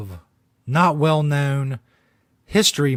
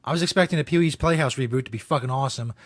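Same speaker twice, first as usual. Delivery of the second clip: normal talking voice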